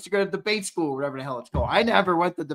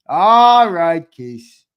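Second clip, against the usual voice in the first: loud all right; 11 dB louder, much slower than usual. Shown as a demonstration.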